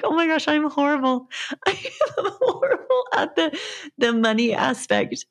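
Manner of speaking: high, giggly self-mockery; laughing at herself. Answering husband about unpaid clients, laughing high. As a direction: laugh through the confession. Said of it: "I kind of had to laugh about that".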